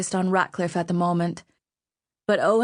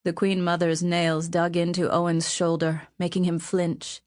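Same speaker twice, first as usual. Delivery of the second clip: ordinary delivery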